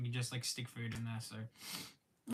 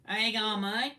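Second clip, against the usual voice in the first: higher, louder hammed-up Aussie greeting; full-on Aussie accent; 7 semitones higher, 4 dB louder than usual. Shown as a demonstration. Streamer showing off for viewers, full-on Aussie accent. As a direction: lay the Aussie accent on thick, with a laugh either side. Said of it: "just full on Aussie accent".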